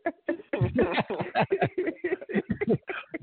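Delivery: long, high laughing fit; real drawn-out high laugh; cracking up. Real, not acted. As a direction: laugh helplessly at your friend's cursing. Cracking up over the cursing.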